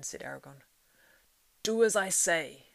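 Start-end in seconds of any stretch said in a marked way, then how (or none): 1.6-2.7 slow, loud, fierce command. A narrator's reading. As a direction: lay down the order, no argument. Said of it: "said Gandalf fiercely".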